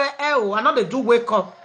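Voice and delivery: ordinary delivery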